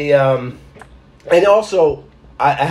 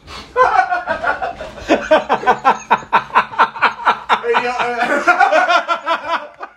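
long, high-pitched helpless laughter; laughing fit; 12 semitones higher than usual. Real, not acted. Spitter loses it beside co-host, helpless laughing. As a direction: crack up and keep going. Cracking up after spitting again.

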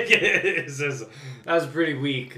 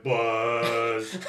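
deeper buzz cry, laughing; lower Electabuzz impression. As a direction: drop it an octave. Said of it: "let's take that down like an octave".

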